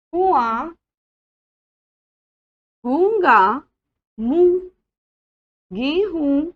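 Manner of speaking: put-on careful teacher reading each word for children; slow word drill. Shown as a demonstration. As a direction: spell out every word clearly, one by one, for the class. Teacher reads word list aloud. (बच्चों को शब्द साफ़-साफ़ पढ़ाती हुई)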